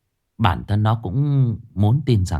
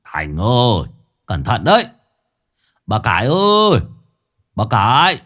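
calling down; 3 semitones higher, 4 dB louder than usual. A narrator's reading. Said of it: "ông còn nói vọng xuống".

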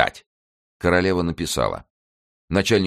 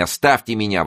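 raised, sharp dismissal; angry exclaim; 6 semitones higher, 4 dB louder than usual. A narrator's reading. Queen rebuffs Mazarin, raised angry cry. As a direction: push him away with it. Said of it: "воскликнула она".

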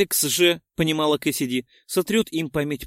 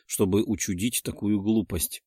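ordinary delivery

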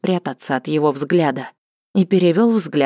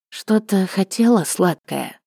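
sultry narrator; 4 semitones higher, slower than usual. A narrator's reading. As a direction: purr it out lazily and suggestively. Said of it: "протянул он своим мягким, томным голосом".

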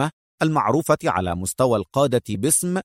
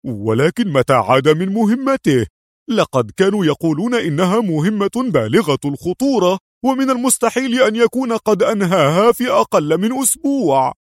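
rising, high-pitched disbelief; amazed; 7 semitones higher, 4 dB louder than usual. A narrator's reading. Cadet doubting the agent's quick return, high and amazed. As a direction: question it in growing astonishment. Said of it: "فعاد يقول في دهشة".

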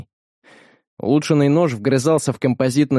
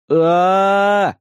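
higher scream while falling; 6 semitones higher, 3 dB louder than usual. A narrator's reading. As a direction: yell as you fall headfirst. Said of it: "Да не кричи ты так". (крик при падении)

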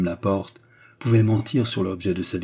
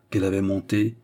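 plain reading voice

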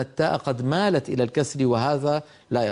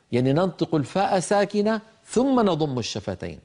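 normal talking voice

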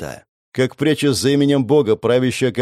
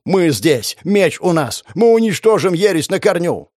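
higher fanatic shouting; rally them with a frenzied cry; berserk rage; 6 semitones higher than usual. A narrator's reading.

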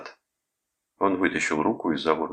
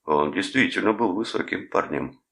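ordinary delivery, nothing marked

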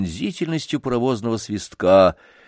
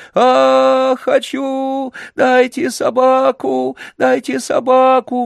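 put-on much higher, loud whiny child's shriek; shrieking spoiled boy; 6 dB louder, slower than usual. A narrator's reading. Spoiled boy shrieks at the footman, demanding the dog. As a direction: squeal like a spoiled brat. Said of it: "взвизгивал мальчик".

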